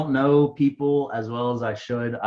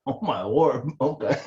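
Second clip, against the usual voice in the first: ordinary delivery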